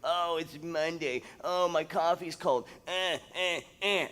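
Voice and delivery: drawn-out mock grumbling; whiny put-on griping; mock whining. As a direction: imitate a morning complainer, dragging out the moans. Mimics negative morning thoughts.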